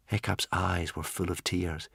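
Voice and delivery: ordinary delivery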